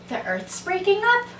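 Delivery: high questioning cry with a gasp; alarmed cry. A narrator's reading. Other rabbit hears the warning, crying out alarmed. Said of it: "cried the other rabbit".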